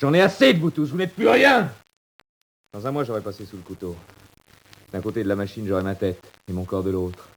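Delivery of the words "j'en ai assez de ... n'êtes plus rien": voix très haute et forte, furieuse; rejette-les tous en criant; explosion de colère. Acted. Prisonnier explose contre ses codétenus. (cri de rage aigu)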